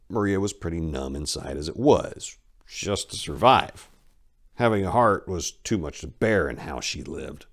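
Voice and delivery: ordinary delivery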